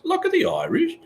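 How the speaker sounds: squeaky high Irish accent attempt; very high, hammed-up Irish lilt; mock Irish accent. Streamer admits he can't do Irish, squeaky fake Irish accent. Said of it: "tutor me on my accent".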